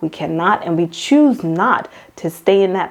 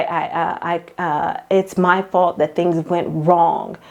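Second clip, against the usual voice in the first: slow, hesitant inner voice full of doubt; slow self-blame; slower than usual. Retold or imitated. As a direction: drag it out like second-guessing yourself. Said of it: "we tend to blame ourselves".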